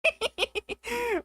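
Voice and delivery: high, light snickering giggle; a cute little snicker. Real, not acted. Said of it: "that adorable giggle you do".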